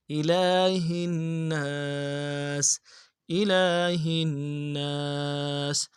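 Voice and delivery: slow melodic tajweed recitation; Quran recitation. Shown as a demonstration. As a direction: chant it slowly with full tajweed. (قرآن کی تلاوت، آہستہ اور سریلی)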